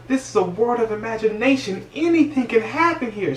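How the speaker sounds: high, dreamy proclamation; old lady marveling at the imaginary world, high and rapturous. Retold or imitated. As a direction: announce it like a dream come true. Gloria rejoicing in the world.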